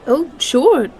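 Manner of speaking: ordinary delivery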